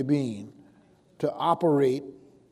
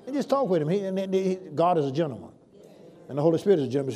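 ordinary talk

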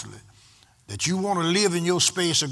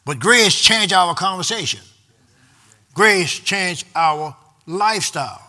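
building preaching; 3 semitones higher, 6 dB louder than usual. Real, not acted. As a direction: drive each point home like a preacher building to a peak. Preacher builds to full force.

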